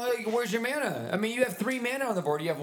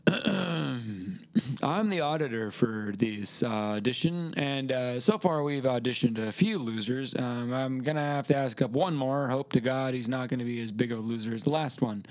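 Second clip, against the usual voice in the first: low voice; 11 semitones lower than usual. Acted. Comedic auditor character.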